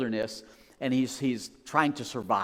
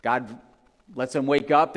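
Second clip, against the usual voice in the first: ordinary talk, nothing marked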